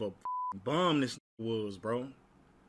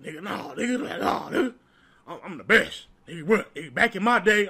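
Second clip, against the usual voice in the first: Willie boasting; 4 semitones higher, 5 dB louder than usual. Retold or imitated. Willie bragging on the court.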